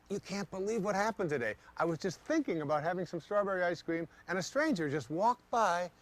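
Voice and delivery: high, breathless amazement; giddy disbelief; excited retelling. Retold or imitated. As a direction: gush about it like a thrilled friend. Telling everybody about the event.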